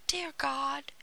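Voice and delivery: understated high plea; crying out in grief. A narrator's reading. Gretel prays in her grief, pleading. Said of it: "she cried".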